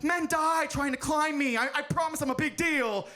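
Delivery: high-pitched, defensive mountain; hammed-up wounded pride; indignant protest. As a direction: plead your importance, stretched and high. Everest protesting in acted argument.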